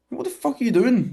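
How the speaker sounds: very high-pitched incredulous question; indignant disbelief. Retold or imitated. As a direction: squeak out your outraged bewilderment. Acted reaction to intruding seatmate.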